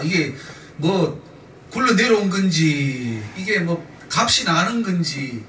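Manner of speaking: slow, drawn-out endings; musing slowly, at a loss; puzzled wondering. Acted. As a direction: stretch the last syllables as if clueless. Acting out not knowing a stone.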